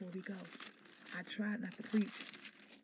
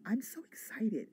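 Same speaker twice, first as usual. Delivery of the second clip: ordinary talk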